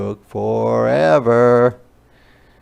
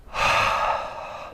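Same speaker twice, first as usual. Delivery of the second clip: nervous sigh. Acted. Took a deep breath, sighs.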